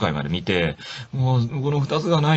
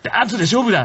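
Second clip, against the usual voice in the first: higher hammed-up challenge; mock challenge; 11 semitones higher than usual. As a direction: throw down the gauntlet, hammed up. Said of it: "って言って".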